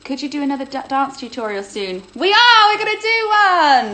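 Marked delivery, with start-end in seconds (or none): 2.2-3.9 high, loud and thrilled; a big excited shout to the viewers; excited announcement. Real, not acted. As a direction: burst out with the news. Excited dance tutorial announcement.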